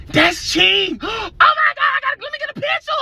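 high, loud excited shriek of a fan; starstruck fan squeal. Retold or imitated. Girls in a car spotting the star, shrieking with excitement. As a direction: squeal like you just spotted a celebrity. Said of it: "they like, gasps".

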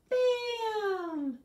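very high, loud bam; shrieked sound-effect bam; shouted bam. Acted. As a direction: burst out like a popping pan. Rhyme punchline sound.

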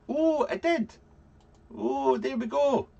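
high delighted oh; surprised it worked, very high; pleasantly surprised. Real, not acted. Setup unexpectedly works.

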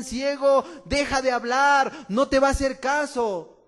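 loud, harsh scolding; scolding rebuke. Acted. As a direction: shut the beggar up. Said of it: "Lo reprendían".

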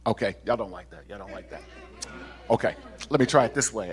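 normal talking voice

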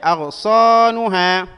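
loud, high, slow "أغصانها"; drawn-out long vowels. Shown as a demonstration. Teacher analysing the word for students, drawn-out long vowels. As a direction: stretch "صا" and "ها" in "أغصانها". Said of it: "ومقطع ثاني مد".

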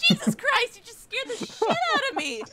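sputtering after spitting out a drink; choking on a sip mid-laugh; spit take. Real, not acted. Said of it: "I just did a spit take".

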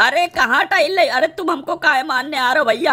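high-pitched mock-panicked pleading; panicked protest. Player pleading with an attacking enemy, panicked protest.

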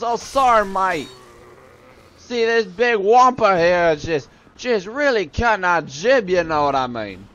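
high, drawling, badly faked Australian; silly cartoon Australian soldier; Australian accent. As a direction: ramble in hammed-up mock Aussie. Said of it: "these guys have Australian accents".

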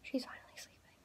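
hushed whisper so she sleeps. Real, not acted. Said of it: "I'm also whispering".

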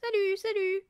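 high parrot voice; squawking parrot. A narrator's reading. Parrot hidden in the chimney, squawking high. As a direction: call out like a parrot. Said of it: "lui lança le perroquet".

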